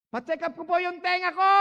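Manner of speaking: high and loud, spelled out for a deaf ear; patient shouting his request to a deaf doctor; speaking loudly to a deaf man. Retold or imitated. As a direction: raise your voice so the doctor hears. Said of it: "laksan niyo po yung boses niyo".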